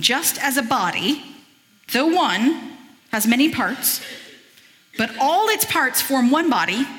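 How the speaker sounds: measured, lifted reading of a Bible passage; scripture reading. Acted. Preacher reading Corinthians to the church, measured and lifted.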